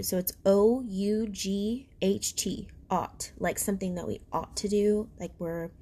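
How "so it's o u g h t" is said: "O U G H T" spelled slowly letter by letter; spelling it out. Shown as a demonstration. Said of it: "I'll spell it for you".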